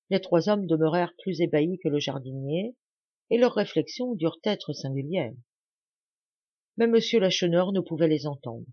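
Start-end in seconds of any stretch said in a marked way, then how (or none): none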